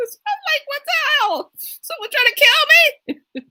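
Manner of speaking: very high squeal, half laughing; laughing mock alarm. Woman retelling her fright at the tailgater, mock-alarmed and laughing. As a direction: replay your alarm through giggles.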